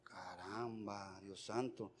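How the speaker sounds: very soft, low aside to himself; hushed, low private reaction; muttered dismay. Retold or imitated. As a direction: murmur it under your breath, taken aback. Private reaction to a money request.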